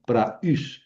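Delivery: "Pra, us" broken before the u; two separate syllables. Shown as a demonstration. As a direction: keep "Pra" and stressed "us" apart. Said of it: "us, segunda sílaba".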